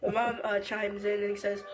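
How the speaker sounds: usual speaking voice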